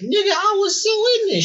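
squeals high with excitement; high-pitched excited voice. Real, not acted. Excited memory of Adult Swim.

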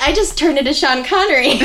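laughing, hammed-up Scottish accent like Sean Connery. Narrator mocking her own audiobook Scottish accent, laughing. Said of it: "I'm trying to do the Scottish accent".

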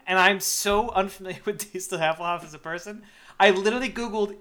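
ordinary talk

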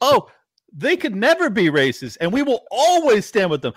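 loud, high, hammed-up mock pledge; mock a gushing politician at full volume; sarcastic high loud parody of a loyalty vow; mocking impression. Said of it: "And then to say".

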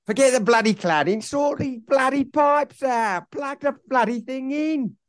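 high, hammed-up ranting fan; mock rant at owner. Fan ranting at owner Prince about pipes, hammed-up high voice.

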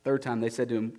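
plain reading voice

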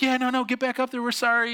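high, hurried coaxing voice; mock apologetic sisters. Sisters coaxing brother to climb back up, mock apologetic.

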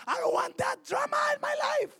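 high shouted refusal as Mary; mock shout. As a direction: yell it like Mary wanting no drama. Preacher voicing Mary refusing.

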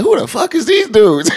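high, laughing mock of a suspicious stare; mocking disbelief. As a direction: play the stranger sizing them up, laughing. Strangers eyeing them at club.

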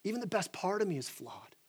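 broken confession. Retold or imitated. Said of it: "He says".

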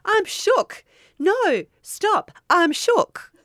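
high-pitched shout of shock. Pretend. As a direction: shriek it, totally shaken. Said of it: "I'll be shouting".

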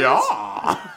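very high, loud British "yeah"; mock British accent. Shown as a demonstration. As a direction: squawk out an absurd British accent. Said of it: "That's my British accent".

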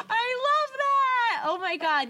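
high, drawn-out, loud squeal; real delight. Real, not acted. Co-host loves the ghost note joke, squealing delight. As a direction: gush over the joke.

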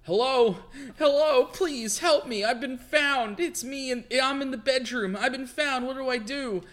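high, frantic plea for help; a frightened noblewoman begging a psychic; panicked lady voice. Acted. Winchester lady calls for help.

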